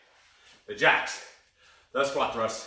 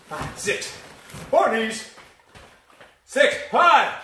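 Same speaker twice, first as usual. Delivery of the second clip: calling out counts; 4 semitones higher, much slower than usual. Real, not acted. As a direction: punch out the numbers like a trainer. Calling out counts mid workout.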